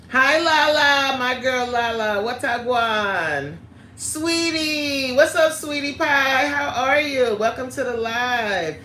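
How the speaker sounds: high, drawn-out sing-song hello; excited greeting. Real, not acted. Greeting viewers arriving in the live chat.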